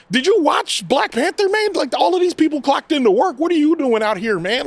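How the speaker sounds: high-pitched, incredulous mock scolding; exasperated director. Reviewer as director berating actor over bad accent, exasperated. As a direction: act out a director pleading with an underperforming actor.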